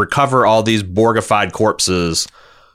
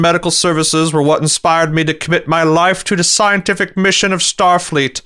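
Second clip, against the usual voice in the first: higher, stately Picard voice; Patrick Stewart impression; 7 semitones higher than usual. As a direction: deliver it like a grand English starship captain. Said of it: "this is my Patrick Stewart".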